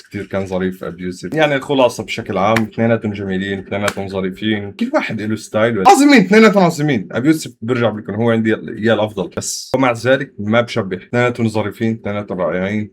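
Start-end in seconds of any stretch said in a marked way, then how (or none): 5.9-7.1 much higher and louder praise; high, loud excited praise of both rappers. Real, not acted. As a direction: burst out with enthusiasm. Enthusiastic verdict on both.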